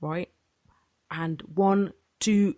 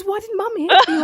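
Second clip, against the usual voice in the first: laughing disbelief; 14 semitones higher, 7 dB louder than usual. Retold or imitated. Sister's shocked question, laughing.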